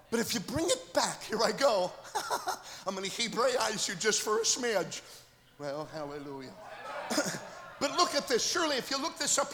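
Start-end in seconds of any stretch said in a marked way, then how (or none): none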